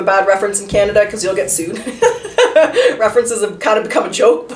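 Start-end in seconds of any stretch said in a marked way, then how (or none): 1.8-2.9 a sudden high burst of laughter. Real, not acted. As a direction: laugh out, high and bright, at your own joke. Amused by bad-reference joke.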